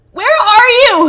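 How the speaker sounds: desperate scream. A narrator's reading. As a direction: scream into the forest. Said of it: "he screams".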